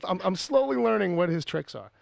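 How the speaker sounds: ordinary delivery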